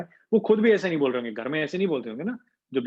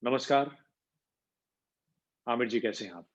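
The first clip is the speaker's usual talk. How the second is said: authoritative greeting; 4 semitones lower, 5 dB quieter than usual. Shown as a demonstration. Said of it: "तो ये authority हो रही है".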